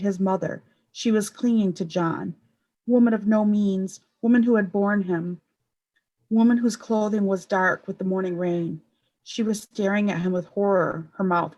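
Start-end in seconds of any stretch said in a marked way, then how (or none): none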